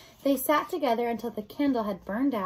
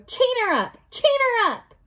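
high, loud, repeated frantic order; shouting; 13 semitones higher, 8 dB louder than usual. Acted. Rat orders Mig to chain the princess, frantic shouting. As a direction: bellow the order, pitch soaring. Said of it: "shouted Roscuro".

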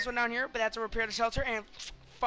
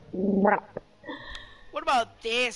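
drawn-out question; 7 dB louder, much slower than usual. Real, not acted. Stretched-out excited question.

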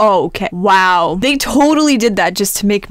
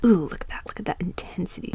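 hushed, breathless whisper over the eyes; whispered admiration; awed whisper; 2 semitones lower, 10 dB quieter than usual. Real, not acted. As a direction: breathe it out in awe. Awed at own fierce avatar.

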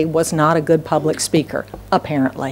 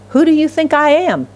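God's rebuke; 6 semitones higher than usual. Acted. Preacher voicing God's rebuke.

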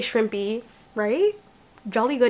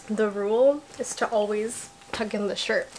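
ordinary talk

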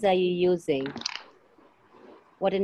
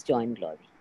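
ordinary talk, nothing marked

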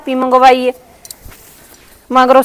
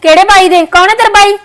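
angry sister-in-law; 5 semitones higher than usual. Acted. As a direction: bark at her to get lost. Sister-in-law berating at the door. (ਭਾਬੀ ਨਨਾਣ ਨੂੰ ਗੁੱਸੇ ਨਾਲ ਝਿੜਕਦੀ)

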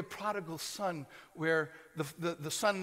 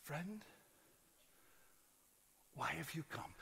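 quiet, tender question to a betrayer; soft and gentle; 4 semitones lower, 14 dB quieter than usual. Acted. Jesus softly asking Judas. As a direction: say it softly, like to a friend.

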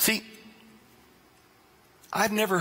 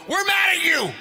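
high, loud mock outrage; mock angry French; 10 semitones higher, 4 dB louder than usual. French colleagues scolding him, high loud mock anger. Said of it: "and they go".

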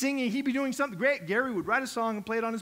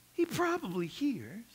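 ordinary delivery

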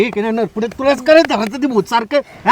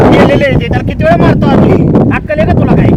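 loud, raised-pitch telling-off; angry scolding; 4 semitones higher, 5 dB louder, faster than usual. Acted. Villager berating the troublesome ghost, loud angry scolding. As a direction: scold loudly with rising exasperation.

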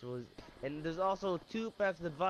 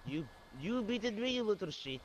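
put-on Russian accent; 4 semitones higher than usual. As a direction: scold him in broken Russian English. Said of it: "your Russian accent keeps turning on and off".

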